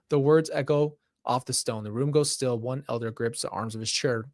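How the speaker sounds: usual speaking voice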